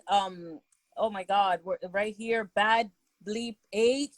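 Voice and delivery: normal talking voice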